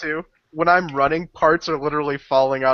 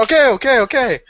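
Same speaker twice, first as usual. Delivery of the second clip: higher, louder backing-down protest; hurried giving in; 10 semitones higher, 5 dB louder than usual. Acted. Player's character yields as he is attacked, raised protest. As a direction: blurt it to fend him off. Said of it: "when he goes to Berdami, I go".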